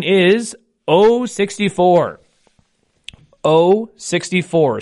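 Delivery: slow drawn-out number call; bingo caller. Acted. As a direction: call the ball slowly and clearly. Calls the first ball.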